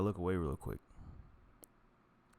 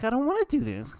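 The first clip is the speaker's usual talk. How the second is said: much higher, louder, squeaky self-talk; squeaky inner voice; 9 dB louder than usual. Retold or imitated. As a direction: squeal it like a panicked little voice. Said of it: "I'm like".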